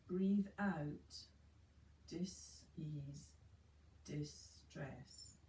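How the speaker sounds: slow, soft meditation voice with long pauses; guided meditation. Acted. Yoga teacher guiding students into relaxation, slow and soft. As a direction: ease the class into calm, one breath at a time.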